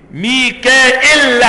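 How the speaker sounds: ميكائيل said slowly with hamza, no ya and a doubled final lam; ميكائيل drawn out, ending on a heavy doubled lam. Shown as a demonstration. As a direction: hold the lam of ميكائيل, doubling it, slow and careful. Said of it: "بالهمزة من غير ياء مع تشديد اللام".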